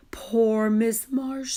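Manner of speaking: slow, lightly sighed pity. A narrator's reading. Girl sighing with pity for the teacher. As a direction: sigh it with mild sympathy. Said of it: "sighed Lisa".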